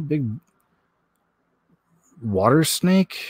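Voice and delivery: ordinary delivery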